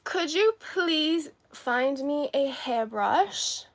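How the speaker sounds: high, slow, drawn-out polite request; playful polite request. Pretend. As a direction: ask the assistant with exaggerated politeness. Playfully giving assistant an errand.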